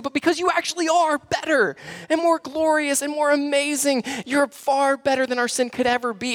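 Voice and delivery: a pastor crying out his love for Jesus; impassioned preaching. Acted. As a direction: pour your heart out to Jesus. Impassioned prayer-like climax.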